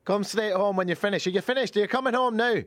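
put-on high accented demand; scolding partner. Partner ordering Taylor home, high scolding accent.

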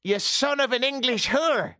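high, yelled Irish insult; Irish accent. Retold or imitated. Homeless man cursing the Englishman, in a shrieking Irish accent. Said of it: "He actually said".

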